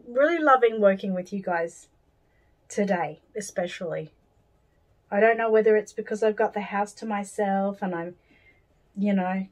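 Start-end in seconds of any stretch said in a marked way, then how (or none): none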